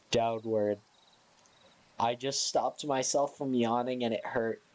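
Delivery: ordinary talk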